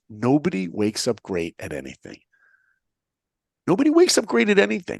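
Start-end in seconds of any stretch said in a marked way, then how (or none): 3.7-5.0 much higher, louder and faster; emphatic repeat. Shown as a demonstration. Speaker repeats his motto to the audience, louder and faster. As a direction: hammer the line home to the listeners. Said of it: "And remember these words".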